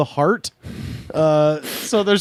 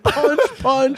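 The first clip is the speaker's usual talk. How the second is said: mock brawler; 7 semitones higher than usual. Echoing the brawler voice.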